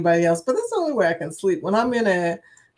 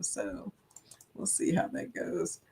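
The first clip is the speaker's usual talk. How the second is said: whispered; 2 semitones lower, 14 dB quieter than usual. Real, not acted. Trailing off unvoiced.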